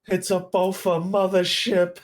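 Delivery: slow, high, hammed-up exclamation; catchphrase delivery. Performing his catchphrase for a fan, hammed-up. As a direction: draw the catchphrase out in a high put-on voice. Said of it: "say your catchphrase".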